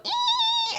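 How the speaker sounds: thin, very high "ee" squeal; squeaking faucet. Retold or imitated. Storyteller imitates a faucet being turned, high squeak. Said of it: "they make a squeaking sound".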